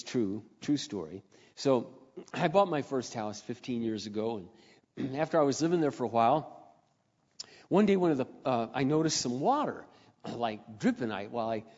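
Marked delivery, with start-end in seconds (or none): none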